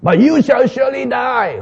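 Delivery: high, stern doom declaration; stern prophecy. Acted. Prophet's doom message acted.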